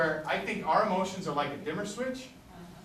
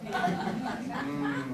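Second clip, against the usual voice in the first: normal talking voice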